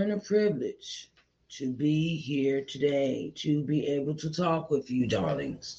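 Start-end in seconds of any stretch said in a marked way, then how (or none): 5.1-5.7 breathy vintage purr. Acted. Sultry aside to the audience.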